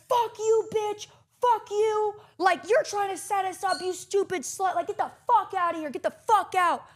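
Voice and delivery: mock family screaming insults; furious yelling. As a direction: yell them out of the room. Family screaming at her, retold.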